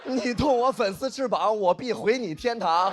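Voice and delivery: high, slow declamation; grandly overacted vow of revenge; mock heroic threat. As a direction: declaim it like a hammed-up hero. Acted heroic threat to guard.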